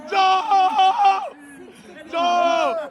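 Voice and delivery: high ringside call. Real, not acted. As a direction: call his name across the hall. Calling out to the boxer.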